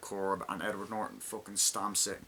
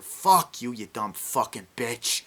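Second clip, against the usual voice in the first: angry yell at mother; 2 semitones higher, 8 dB louder, slower than usual. Retold or imitated. Son screaming at his mother.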